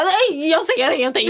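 hammed-up helpless voice; mock helpless. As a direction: play the blind fool groping in the dark. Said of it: "och bara".

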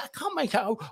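polite operator who can't hear; English copy taker. Retold or imitated. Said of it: "She said".

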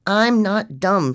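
loud, slow, emphatic shout; husband finally snapping, loud and slow; angry shout. A narrator's reading. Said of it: "shouted Anthony".

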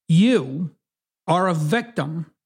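ordinary talk

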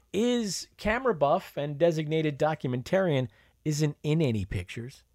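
ordinary delivery

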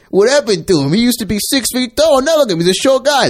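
high, mocking voice of an onlooker. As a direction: pitch it way up, teasing. Mock voice in trailer retelling.

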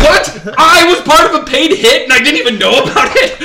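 loud, high, laughing disbelief; incredulous outburst. Retold or imitated. Telling friends he was unknowingly part of a hit, incredulous. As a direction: retell your shock at full volume, half laughing. Stunned reaction retold while laughing.

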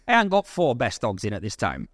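usual speaking voice

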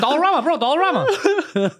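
hammed-up excited announcing; mock excited announcing. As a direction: point it out like a kid. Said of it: "we're driving, like".

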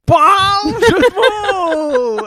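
high, loud, drawn-out crow; triumphant pun announcement. Real, not acted. Joker celebrating his own bad pun to groaning co-hosts, triumphant shout. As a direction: belt it out long and high like a victory cry.

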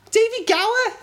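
very high, loud Scouse squawk; hammed-up, high-pitched Scouse accent. Shown as a demonstration. Quiz guest naming the last cricketer, in a Scouse accent. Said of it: "I'll do Scouse".

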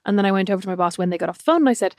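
usual speaking voice

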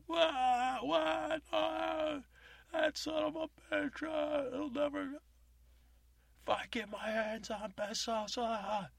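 high, loud panicked ranting; freaking out. Retold or imitated. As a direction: rant like a man losing it. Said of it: "starts freaking out even more".

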